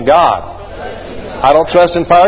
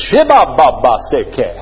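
sputtering engine sound; 4 semitones lower, slower than usual. Pretend. Mock engine cranking gibberish.

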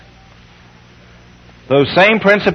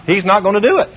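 ordinary talk

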